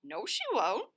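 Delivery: a fox reassuring its prey; lightly voiced sly fox; Foxy Loxy's voice. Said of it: "said Foxy Loxy".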